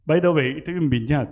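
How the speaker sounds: ordinary talk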